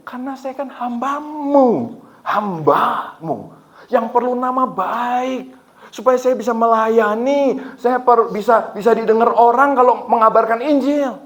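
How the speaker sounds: high, drawn-out indignant pleading; acted protest with stretched words; retold anger at God. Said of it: "Jadi saya marah".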